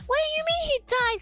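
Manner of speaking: high, falling incredulous protest; retold dismay at a spoiler; upset disbelief. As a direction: demand an explanation in shock. I was upset.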